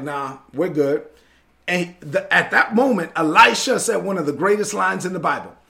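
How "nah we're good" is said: low, soft, slow and relaxed; unbothered calm. Retold or imitated. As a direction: shrug it off like nothing's wrong. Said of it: "he was at peace".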